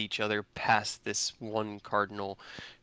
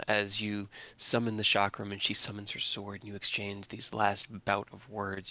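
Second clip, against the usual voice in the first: ordinary talk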